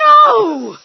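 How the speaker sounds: loud, high flat refusal; shouted no. Acted. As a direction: cut him off hard. Wife cuts off husband.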